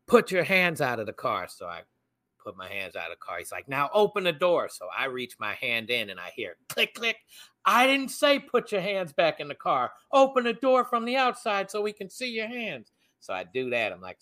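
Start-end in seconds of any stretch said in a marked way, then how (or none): 0.1-1.5 mild mock police yell, raised pitch; retold yell. Officer behind the blocked-in car to driver, retold yell. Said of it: "the guy behind me yells". 3.7-4.7 mock officer's command, pitched up; retold police order. Officer to blocked-in driver, retold yelled order. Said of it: "the guy behind me yells". 7.6-12.9 high-pitched imitation of a yelling officer; retold police yelling. Armed officer to driver reaching inside, mock yell. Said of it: "the guy behind me yells".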